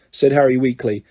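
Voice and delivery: ordinary delivery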